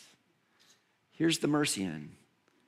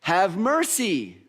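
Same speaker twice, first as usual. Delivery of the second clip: loud, high, drawn-out plea; yelling out; 6 semitones higher, 4 dB louder, slower than usual. Retold or imitated. Blind person calling to Jesus going by, yelling out. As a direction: call out for mercy, stretching the words slowly. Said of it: "And he yells out".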